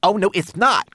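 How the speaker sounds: high, loud little creature contradicting; excited cry. A narrator's reading. Homunculus contradicts brownie about ravens, excited cry. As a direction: cry out in heated protest. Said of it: "cried Twigleg".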